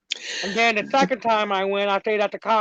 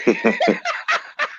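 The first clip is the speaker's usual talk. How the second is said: laughing. Real, not acted. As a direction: burst of high laughter. Laughs about ghost camper.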